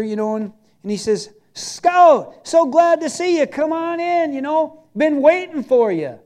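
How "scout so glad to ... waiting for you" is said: high, loud, delighted welcome; effusive greeting. Retold or imitated. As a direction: imitate a pastor gushing hello at the door. Pastor greets him warmly at door.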